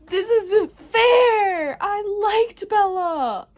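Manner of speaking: hammed-up crying wail, high, loud and slow; mock wail. Reader wailing over her favourite character's cancer, hammed-up sobbing. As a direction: wail it like a dramatic fake sob.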